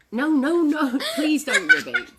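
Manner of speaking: loud, high mock despair; exasperated plea. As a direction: beg loudly as if at wit's end.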